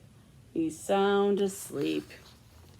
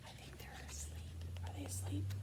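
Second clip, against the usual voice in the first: hushed whisper; soft unvoiced whisper; 21 dB quieter, faster than usual. Real, not acted. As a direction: whisper so the robots stay asleep. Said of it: "whispering to children".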